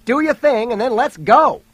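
high, loud urgent yell; shouted hurry-up command. A narrator's reading. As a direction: yell an order across to her. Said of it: "he yelled to Jody".

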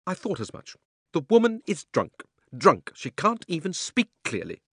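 high, prim scolding aunt; indignant old aunt. A narrator's reading. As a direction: scold like a stiff old lady.

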